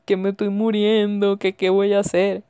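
half-hearted whiny plea to mom; mock crying complaint; mock tearful. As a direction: imitate a weepy call home, hammed-up helplessness. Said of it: "llama a la mamá llorando y le dice".

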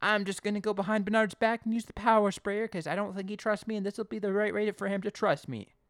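high, loud, mock-determined plan; hammed-up naive resolve; scheming eager helper. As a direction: act out a trainee convincing himself. Acting Simon's scheming resolve.

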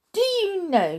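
high, loud, eager opening; eager affection. A narrator's reading. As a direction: burst out with a happy confidence. Child hugging woman, sudden confession.

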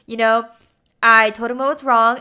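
defensive explaining. Retold or imitated. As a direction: act out a husband justifying himself. Husband defends himself in acted quarrel.

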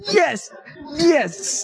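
high-pitched re-enacted "yes!" of triumph; exultant cheer. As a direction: cheer it out high, reliving your glee. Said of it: "That's why I expressed".

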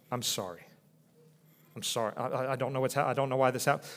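soft, low apology for crying; mock tearless apology. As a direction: apologise quietly, as if embarrassed by tears.

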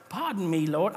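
Gideon in a mock British accent, softly. Preacher reading Gideon's plea to God, in a British accent. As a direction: put on a British accent like a TV disciple. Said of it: "I'll read it in a British".

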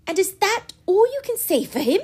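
put-on high, loud indignant young woman; indignant girl's outcry. A narrator's reading. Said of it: "cried Marianne indignantly".

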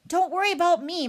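high, loud mother bear growl; forceful, strained bear voice. A narrator's reading. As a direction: growl it urgently at the cubs. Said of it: "Mother Bear growled".

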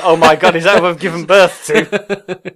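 loud, slow, horrified gasp of a new mother; mock shocked mother. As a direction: play the mother recoiling in horror at the baby. Shocked mother voiced in a story.

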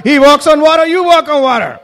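loud, high preaching peak; climactic exclamation. Real, not acted.

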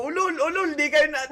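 high-pitched jeering, re-enacted; mock taunting. Retelling how he dared the horror-house ghosts, high mock jeer. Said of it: "habang nagsisigawan".

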